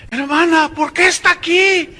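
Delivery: high, loud, overdramatic demand; hammed-up cry across hell; mock shouted question. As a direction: call out to her like bad melodrama. Acted questioning in mocked testimony.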